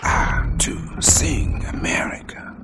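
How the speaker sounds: slow breathy whispered recitation; hushed poetry reading over music; whispered poem. Acted.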